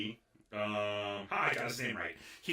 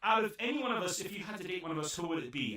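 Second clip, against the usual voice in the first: high, loud eager pestering; hammed-up eager boys; eager asking; 9 semitones higher, 5 dB louder than usual. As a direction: ask her as if begging for her pick. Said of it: "they all say".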